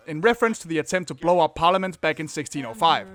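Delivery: ordinary talk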